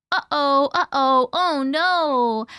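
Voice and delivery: playful taunting uh-oh; playful teasing. Retold or imitated. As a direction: tease her like a naughty child. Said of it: "with a playful tone of voice".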